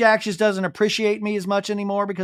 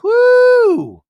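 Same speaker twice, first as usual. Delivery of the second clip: loud, much higher whoop; excited whoop; 11 dB louder than usual. Real, not acted. Host reacting to Jesse's warning, excited whoop. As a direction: let out a big whoo.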